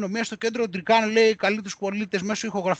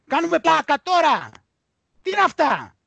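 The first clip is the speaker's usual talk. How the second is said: higher, louder indignant outburst; outraged disbelief; 8 semitones higher, 5 dB louder than usual. Real, not acted. Host outraged at the article about two old men: indignant outburst. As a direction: blurt it out, scandalised by what they wrote.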